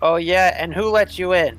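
acted confrontational comeback; challenging. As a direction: challenge the intruder's story. Character challenges the intruders' story.

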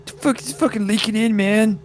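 high-pitched acted whine through a door; slightly muffled complaint. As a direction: gripe in a high voice from behind a closed door. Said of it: "it's only slightly muffled now".

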